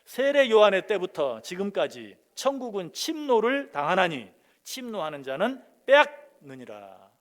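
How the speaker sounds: slow, weighty reading of the verse; scripture read aloud. Shown as a demonstration. Preacher reading the verse aloud to the congregation, slowly. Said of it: "우리 같이 한번 읽어볼까요".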